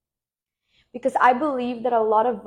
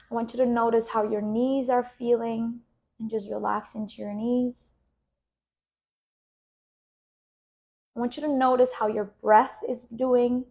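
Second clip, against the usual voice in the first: guided meditation. Acted. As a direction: talk them gently into relaxing. Leads a relaxation exercise.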